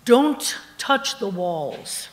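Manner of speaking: slow, emphatic reading of a sign; sign read aloud. Acted. Storyteller reads the guards' warning sign to the audience, slow and emphatic. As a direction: spell it out word by word, slowly. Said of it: "in big, big block letters ... it says".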